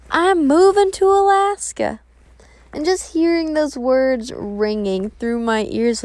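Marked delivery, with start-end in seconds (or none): 0.1-2.0 higher-pitched excited imitation of big news; imitated thrilled announcement, pitched up. Acting out his big announcement.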